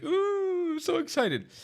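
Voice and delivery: mock a giddy fan, dripping sarcasm. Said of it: "like, giddy".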